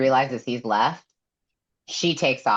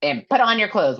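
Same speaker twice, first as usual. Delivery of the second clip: mock order; 11 semitones higher than usual. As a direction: bark it as Nesta, hammed-up. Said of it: "She's like".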